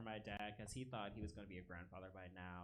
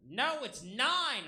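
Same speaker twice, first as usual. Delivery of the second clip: stretched-out scream, much higher and louder; screamed correction; 16 dB louder, much slower than usual. Retold or imitated. Woman in the crowd screaming a number at the comic. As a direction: yell it slowly from the audience. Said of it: "a woman screamed out loud".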